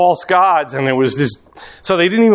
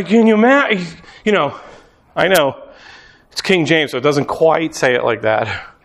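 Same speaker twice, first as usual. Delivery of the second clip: ordinary talk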